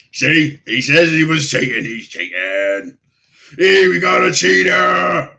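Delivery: high, loud shout to the whole bar; slow, rowdy holler; orc calling out. Acted. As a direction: drag out the accusation at full voice. Orc announces cheater to bar.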